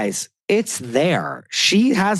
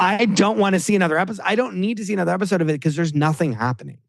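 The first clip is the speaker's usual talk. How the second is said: fast, higher whiny rant; mild mock whine, hurried; mocking impression; 6 semitones higher, faster than usual. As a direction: rattle off her complaint, hamming it up a little. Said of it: "The whole episode is her".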